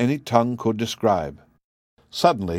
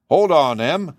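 sudden urgent loud call; exclaiming; 6 semitones higher, 4 dB louder than usual. A narrator's reading. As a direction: burst out to stop her. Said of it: "he exclaimed".

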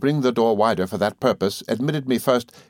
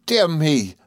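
high, happy exclamation; delighted, pitched up; excitable friend; 7 semitones higher than usual. A narrator's reading.

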